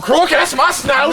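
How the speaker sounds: high, loud yell; shouting. Acted. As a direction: bellow it out in outrage. Yelling about his snow.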